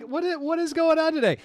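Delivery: high, exasperated mock disbelief; baffled exclamation. Comedian recalling a second fake French fan, high baffled mock outcry. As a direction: act out throwing up your hands in disbelief. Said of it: "and I was like".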